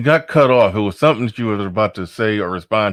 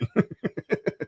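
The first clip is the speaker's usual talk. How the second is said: breathy laugh; 9 semitones higher, 9 dB quieter than usual. Real, not acted. Laughing after the yawn story.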